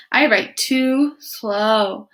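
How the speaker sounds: very slow, drawn-out words; slow turtle. Acted. Turtle telling Iguana why he won't help, very slow. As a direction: drag every word like a sleepy turtle.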